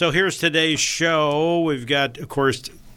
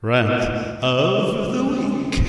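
game show host; 5 semitones higher, much slower than usual. Shown as a demonstration. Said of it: "God, that was so weak".

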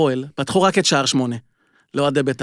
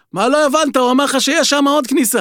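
imitating a guard's high, loud yell; security guard yelling up at the coach, mock exasperated; retold guard yell; 7 semitones higher, 4 dB louder than usual. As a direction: call it out like the annoyed guard at the fence. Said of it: "המאבטח לידי צועק לו".

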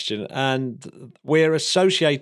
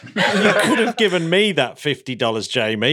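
louder, a bit fast, laughing as he teases; play-scold a friend through a laugh; mock-indignant and laughing; laughing mock complaint; 5 semitones higher, 4 dB louder, faster than usual. Real, not acted. Teasing complaint about wasted money.

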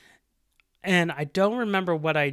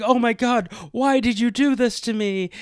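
higher, louder, hammed-up wounded cry; mock distress; 6 semitones higher, 4 dB louder than usual. Faking injury to the bully, hammed-up wounded cry. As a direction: play the victim a bit over the top. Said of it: "I was like".

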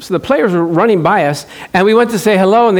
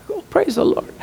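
laughing praise; 13 semitones higher, 8 dB quieter than usual. Real, not acted. Relieved joyful exclamation.

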